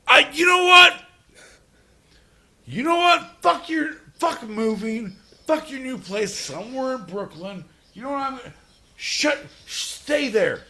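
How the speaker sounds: high, loud angry tirade; comic fury at a friend moving. Real, not acted. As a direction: yell at him not to move. Angry yelling at John.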